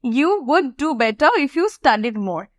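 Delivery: very slow, careful model sentence; slow teaching model. Shown as a demonstration. Teacher rereading the sentence slowly for students. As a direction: read it slowly for learners. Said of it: "কি বলেছে?".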